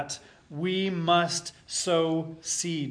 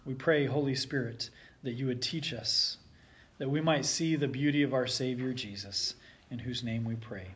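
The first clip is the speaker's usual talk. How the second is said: soft, low prayer voice; 5 semitones lower, 6 dB quieter than usual. Acted. Pastor praying before the sermon, soft and low. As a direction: pray quietly over the congregation.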